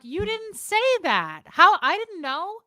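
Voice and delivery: loud, high incredulous comeback; retold frustrated outburst at her boyfriend; exasperated retort. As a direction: fire it back at him in disbelief. Arguing back at boyfriend Tommy.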